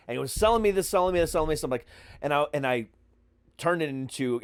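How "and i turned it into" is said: ordinary talk, nothing marked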